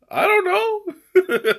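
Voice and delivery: high laughing squeak. Real, not acted. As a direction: laugh through the words. Laughing mock reply.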